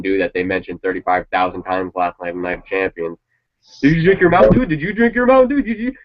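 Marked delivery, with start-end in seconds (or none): 3.8-5.9 much higher, louder mock announcer asking about Mountain Dew; squeaky high parody of a commentator pushing soda. Mocking announcer's Mountain Dew plugs.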